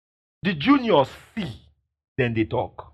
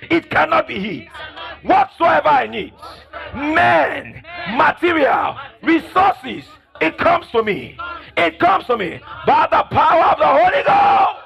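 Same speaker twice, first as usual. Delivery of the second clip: shouted declaration; 10 semitones higher, 4 dB louder than usual. Shown as a demonstration. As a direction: shout it high and loud.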